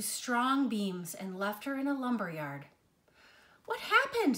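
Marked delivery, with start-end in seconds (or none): 3.6-4.4 sharp high-pitched puzzled cry; the tree can't believe what was done to her; bewildered question. A narrator's reading. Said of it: "the once tall tree wondered".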